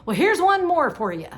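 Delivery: imitating an annoyed viewer's retort; mock snippy retort. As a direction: fire it back like a parting shot. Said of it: "then she said something like".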